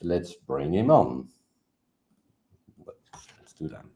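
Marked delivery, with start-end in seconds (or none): 2.8-3.8 whispered aside. Real, not acted. Aside to himself while bringing guest on.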